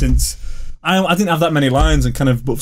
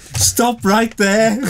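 Scouse line; 9 semitones higher, much slower than usual. Acted. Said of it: "my first line that came out was".

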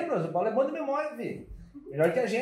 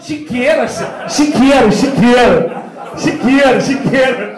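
very loud, emphatic word drill; mock-exasperated shouted repeats; hammered loud repetition; 13 dB louder, slower than usual. Shown as a demonstration. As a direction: belt out each word for a mock lesson. Hammers wrong word in comic drill.

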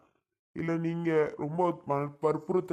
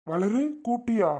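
high, raised call to his wife; higher-pitched questioning call; calling back; 7 semitones higher, 4 dB louder than usual. Acted. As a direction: answer her call, pitched well up and eager to respond. Husband answering wife's call.

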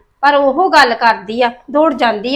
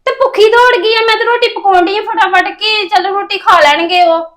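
dismayed; 6 semitones higher than usual. Acted. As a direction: exclaim in disbelief, voice jumping up. Sister-in-law dismayed girl left hungry.

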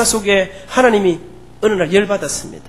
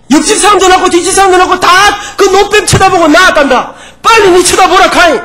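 higher, louder pleading in dialect; a father begging his bitten son to look; father pressing his son to look at the serpent, loud and urgent; urging father; 8 semitones higher, 6 dB louder than usual. Acted. Father scolding stubborn son.

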